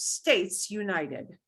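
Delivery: slow word-by-word States United; slow literal gloss. Shown as a demonstration. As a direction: spell out States United slowly. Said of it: "Estados Unidos".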